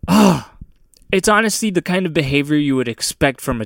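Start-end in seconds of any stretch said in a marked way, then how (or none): 0.1-0.4 loud, high "ugh" of disgust; exasperated groan. Real, not acted. Host fed up with gender reveal fire, exasperated groan.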